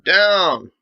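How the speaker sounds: loud high shouted command; one sharp shouted word; cry out. Acted. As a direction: bark the order over gunfire. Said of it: "Biggs cried".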